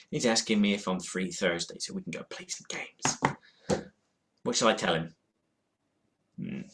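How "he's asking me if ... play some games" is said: soft whisper to the camera; whispered aside. Real, not acted. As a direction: whisper it like a secret. Reading phone message aside.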